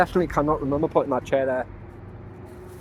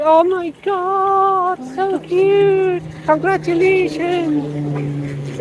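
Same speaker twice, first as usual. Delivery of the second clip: much higher, slow, squealing cashier gushing; weird high-pitched voice; 5 dB louder, much slower than usual. Retold or imitated. Cashier gushing at the engaged couple in a high voice. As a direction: gush it out in a high squeal, stretching each word. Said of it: "In this weird high-pitched voice".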